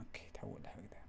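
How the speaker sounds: quiet whispered echo to himself; whispered repeat. Real, not acted. Tutor repeats the step to himself, whispering. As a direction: say it again barely voiced.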